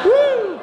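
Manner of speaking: high, loud woo; hammed-up party whoop; mock cheer. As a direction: whoop in sarcastic celebration. Mock cheer for PowerPoint presentation.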